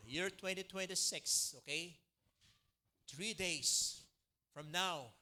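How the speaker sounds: loud, measured proclamation to the church; solemn declaration. Real, not acted. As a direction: proclaim it over the people. Congregation stands for declaration. (taimtim na pagpapahayag)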